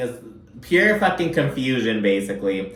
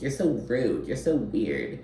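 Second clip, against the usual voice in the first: mocking mutter; 6 dB quieter than usual. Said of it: "as she's calling Taylor weird and rude".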